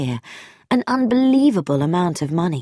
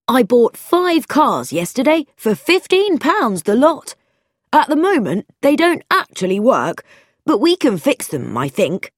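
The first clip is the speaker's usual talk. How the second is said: higher, animated friend's voice, louder in bursts; eager letter voice; 6 semitones higher, 3 dB louder than usual. Acted. Friend writes to offer a garage job, eager higher voice.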